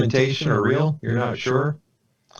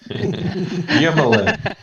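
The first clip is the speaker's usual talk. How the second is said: laughing; 7 semitones higher, 3 dB louder, much slower than usual. Real, not acted. GM laughs at player's joke name.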